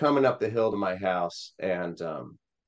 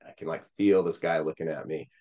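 ordinary talk

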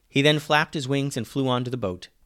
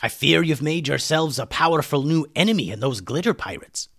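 put-on voice of a rescued pirate; pirate character giving a warning; 4 semitones higher than usual. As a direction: warn your allies in lively character voice. Said Hummus.

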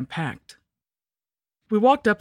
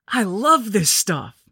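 high, loud, slow relish; drawn-out childish enthusiasm; delighted kid; 6 semitones higher, 5 dB louder, much slower than usual. A narrator's reading. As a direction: gush it like a candy-mad little boy. Kid gobbling candy, story.

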